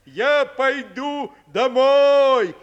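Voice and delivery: loud, slow sly wolf. A narrator's reading. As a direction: announce it slyly like the wolf. Wolf fakes leaving.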